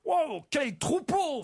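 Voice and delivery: high, drawn-out cry of wonder; exclaiming. A narrator's reading. City man admiring a shepherd's flock, exclaiming. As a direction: joke character marvels loudly, slowly. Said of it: "fait Pierre".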